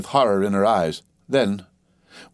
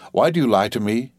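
ordinary delivery

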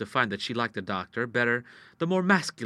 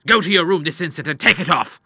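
loud, angry, raised-pitch command; thundering order; 6 semitones higher, 10 dB louder than usual. Acted. Father ordering daughter to her room, angry thundering. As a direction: thunder it at the girl. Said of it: "thundered the doctor".

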